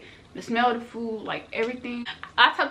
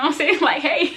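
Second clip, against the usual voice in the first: breaking into high laughter; laughing; 7 semitones higher, 8 dB louder than usual. Real, not acted. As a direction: crack up while replaying your greeting. Cracks up imitating own greeting.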